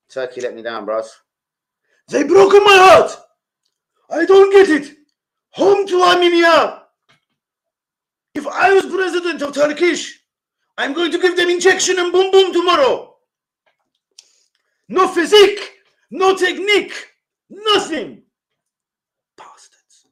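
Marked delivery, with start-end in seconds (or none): 2.1-18.2 high, loud put-on broken-English fan voice; outraged fan wailing at the national side; heartbroken fan caricature. 19.3-20.0 a bitter whisper under the breath; whispered curse. Acted. Muttered curse after the rant.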